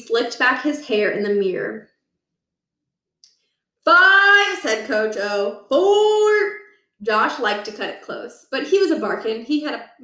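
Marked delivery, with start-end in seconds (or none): 3.8-4.6 countdown shout. Acted. Coach counting down. 5.7-6.6 countdown shout. Acted. Coach counting down.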